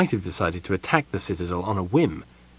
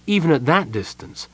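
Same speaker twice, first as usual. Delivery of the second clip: ordinary delivery, nothing marked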